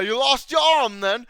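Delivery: loud, high-pitched comic knight; hammed-up sketch-comedy taunt; Monty Python impression. Quoting that one scene from Monty Python, hammed-up knight. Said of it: "Monty Python".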